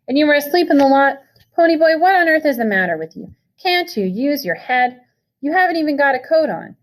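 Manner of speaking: high, loud lecture, slowing on the head line; furious scolding. A narrator's reading. Older brother lecturing Pony about sleeping in the lot, furious. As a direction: hammer him with each reproach. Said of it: "He was shouting".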